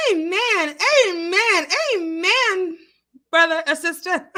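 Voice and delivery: emphatic amens. Real, not acted. Emphatic amen to chat.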